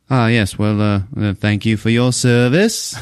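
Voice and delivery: mock British accent, slowing at the end; hesitant British politeness, drawing out the thanks; Seth MacFarlane. British executive thanking a soldier, mock-polite British accent. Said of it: "he's playing it British".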